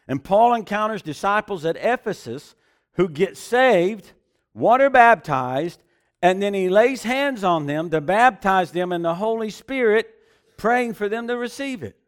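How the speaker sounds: loud, high preaching with building fervour; full-force preaching. Acted. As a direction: preach it out to the congregation.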